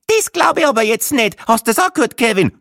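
an indignant wife appealing to Kevin; outraged tourist voice. A narrator's reading. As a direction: protest as if scandalised. Outraged woman at table.

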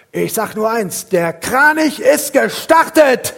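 very high, loud and slow, laughing; slow loud triumphant cry; triumphant proclamation. Retold or imitated. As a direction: announce it like a victory code. Triumphant phone message.

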